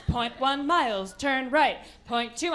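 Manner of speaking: slow, robotic navigation voice; measured, impersonal machine announcer; GPS lady. Retold or imitated. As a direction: read out directions like a satnav, word by word. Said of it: "my G P S lady".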